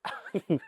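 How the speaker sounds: quick breathy chuckle; short real laugh. Real, not acted. Teacher to class, laughing at her own cue. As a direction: let a little laugh slip out. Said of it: "Sorry, I'm laughing".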